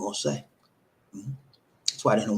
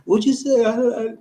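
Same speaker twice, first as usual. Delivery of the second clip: flustered mock reply; 12 semitones higher, 5 dB louder than usual. Acting out a nervous listener.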